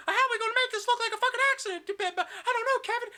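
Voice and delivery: frantic, very high loud falsetto; hammed-up panicky whine. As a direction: squeal like a fretting sidekick. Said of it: "[name]'s like".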